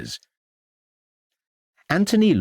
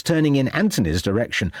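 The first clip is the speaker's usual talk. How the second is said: ordinary delivery, nothing marked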